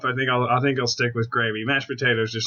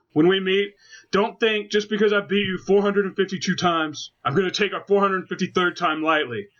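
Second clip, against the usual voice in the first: wrestling promo voice; 8 semitones higher than usual. Retold or imitated. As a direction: cut a promo like a pro wrestler. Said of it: "So I was like".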